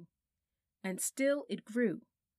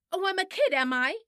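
much higher, louder furious outburst; real rage; 12 semitones higher, 10 dB louder than usual. A narrator's reading. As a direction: explode at him in fury. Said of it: "who was now in a real rage".

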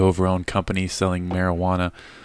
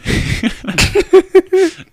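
shrill burst of laughter; high laugh; 10 dB louder than usual. Real, not acted. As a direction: cackle high and loud. Laughing at her own story.

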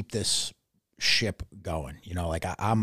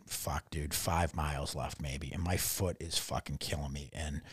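ordinary delivery

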